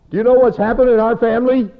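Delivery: high, loud acted protest; hammed-up anguished challenge; distraught, imitated. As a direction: cry out in mock outrage. Retold outburst of a sufferer.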